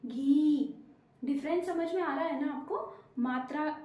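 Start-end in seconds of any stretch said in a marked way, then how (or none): 0.1-0.7 घी with the ई drawn out long and louder. Shown as a demonstration. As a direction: stretch the ee in घी for बड़ी ई. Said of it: "अब बड़ी ई की लगाई तो".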